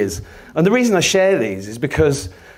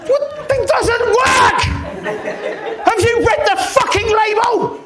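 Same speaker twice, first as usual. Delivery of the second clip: high, shouted comeback drawn out for force; acting out an angry argument at high pitch; furious retort; slower than usual. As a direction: shout back in exasperated disbelief. Retold angry retort in quarrel.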